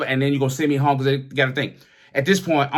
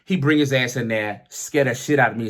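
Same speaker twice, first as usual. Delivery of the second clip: ordinary talk, nothing marked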